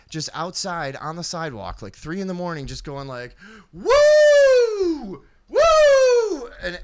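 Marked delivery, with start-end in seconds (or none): 3.7-6.5 very high, loud sustained whoop; long piercing "woo" howl; imitated screaming woo. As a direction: imitate a late-night street screamer. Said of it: "fucking scream all night".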